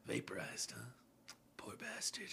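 very soft, near-whispered imitation; hushed movie quote. Quoting film's quiet closing line.

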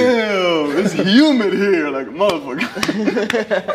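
high, laughing mock gripe; exaggerated complaining. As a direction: ham up the heat complaint. Acted complaint about Miami humidity.

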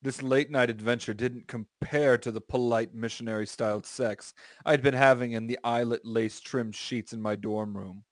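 ordinary delivery

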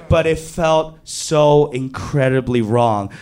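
ordinary talk

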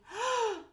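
high, sharp intake of breath; startled gasp. A narrator's reading. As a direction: gasp as if jolted awake. Startled by a loud bang.

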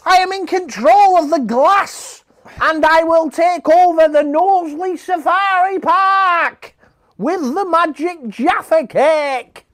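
high, slow, shouted villain boast; villain gloating. Pretend. Imagining the dog as a power-mad villain, high gloating shout. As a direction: cackle it out like a cartoon villain with a magic cake. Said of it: "But, like".

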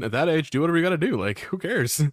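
muffled behind a mask; inside a rubber mask. Real, not acted. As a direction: talk through a rubber mask. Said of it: "I'm gonna take this mask off".

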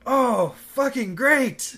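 high, loud, slow sarcastic shout; sarcastic yell. Retold or imitated. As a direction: drag the sarcasm out at full volume. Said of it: "hilarious line delivery".

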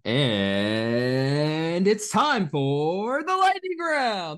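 slow, drawn-out announcer intro. Acted. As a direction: boom it out like a show announcer. Announcing the lightning round segment.

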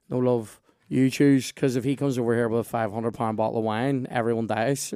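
loud, comic warning; mock warning. Telling partner to choose the wine, loud mock warning. Acting his alarmed reply to partner.

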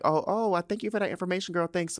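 high, quick, sugary brush-off; imitating Otto patronizing his daughter, high and fast; mock condescending thanks. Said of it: "looking at her like".

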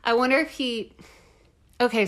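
ordinary talk

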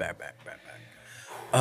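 imitating an echo that fades to nothing; fading echo. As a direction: play the echo dying away. Fake echo of "we're back.

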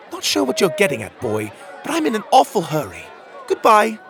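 put-on high, hurried woman dismissing a child; brisk brush-off. A narrator's reading. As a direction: brush him off, ending on a loud, high goodbye.

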